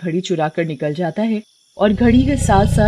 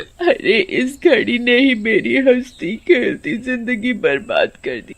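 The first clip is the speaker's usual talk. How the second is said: slow, wailing complaint fading at the end; hammed-up grieving spirit; anguished witch lament; 5 semitones higher, slower than usual. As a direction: mourn a ruined life. Witch laments ruined life.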